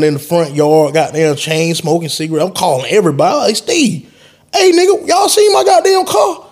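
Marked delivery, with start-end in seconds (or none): 3.6-6.5 frantic shout. Retold or imitated. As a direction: yell it down the phone in panic. Frantic call about missing car.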